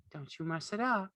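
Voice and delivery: put-on high, quick scolding young wife; mild warning. A narrator's reading. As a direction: warn him lightly.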